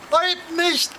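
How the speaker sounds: hoch und laut herausgerufen; gerufen. A narrator's reading. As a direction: energisch abwehrend rufen. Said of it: "Da denkt an Paul und ruft". (Damon ruft der Kokotte hoch und laut „Heut nicht!“ zu)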